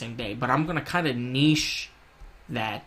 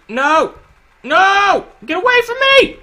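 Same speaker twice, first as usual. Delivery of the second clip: panicked yelling; 15 dB louder, slower than usual. Real, not acted. Fleeing boss, panicked yelling.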